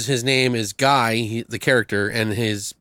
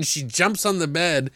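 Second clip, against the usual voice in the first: ordinary delivery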